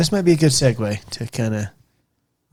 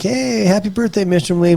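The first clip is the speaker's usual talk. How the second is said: higher, lilting mock birthday hello; imitated cheery greeting; 8 semitones higher than usual. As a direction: play the parents greeting a teacher. Imitates parents' friendly birthday greeting.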